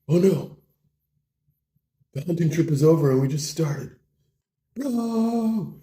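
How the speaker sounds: high, whiny lament; mock dismay. Bro laments the ruined hunting trip, in mock dismay.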